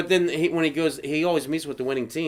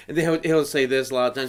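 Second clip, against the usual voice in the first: normal talking voice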